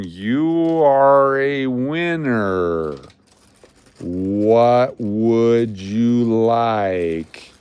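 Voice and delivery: each word stretched, very slow; exaggerated slow-motion speech. Shown as a demonstration. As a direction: spell it out slowly, half teasing. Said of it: "I'll speak slower if that helps you".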